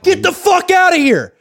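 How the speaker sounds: high, loud, slow shout; furious yell. Shown as a demonstration. Reporter showing how Harbaugh yelled at Boldin. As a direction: yell it like a coach throwing him out. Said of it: "Like that".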